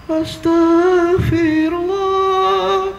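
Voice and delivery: slow, high, wailing prayer with a sob; mock tearful prayer. Parent praying to God about naughty child, mock crying plea.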